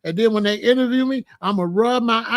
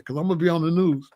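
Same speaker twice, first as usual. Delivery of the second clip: normal talking voice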